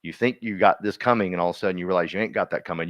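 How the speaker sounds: ordinary talk, nothing marked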